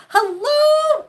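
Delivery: high, loud delighted hello; squealing hello to a pet; excited greeting. Real, not acted. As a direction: greet her with a big bright hello. Greeting a pet Rose loudly.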